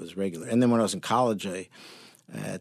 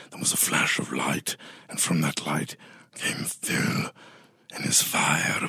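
unhinged villain; 7 semitones lower than usual. Pretend. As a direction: intone it like an ancient dark lord. Guest's mock-epic villain monologue.

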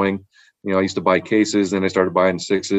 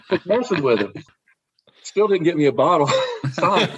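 higher, laughing through the words; 8 semitones higher than usual. Real, not acted. Athlete to podcast host laughing at his own prank story. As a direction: giggle it out, real amusement.

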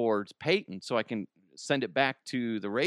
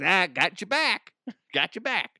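lightly hammed-up chummy buddy, higher pitched; mock buddy reassurance with a laugh between; teasing buddy; 7 semitones higher, 4 dB louder than usual. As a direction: play a jokey pal, pitched up. Imagined buddy teasing after kick.

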